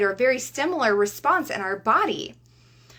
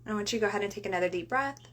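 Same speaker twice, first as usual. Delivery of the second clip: guided breathing. Acted. Leads a breathing exercise.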